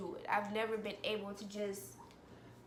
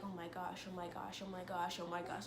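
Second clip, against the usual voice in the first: low, hushed panicky repetition; anxious self-talk; 3 semitones lower, 9 dB quieter than usual. Retold or imitated. Retelling sweaty-handed anxiety to viewers, hushed anxious muttering. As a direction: murmur it over and over under your breath. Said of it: "I just feel like".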